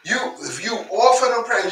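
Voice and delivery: ordinary talk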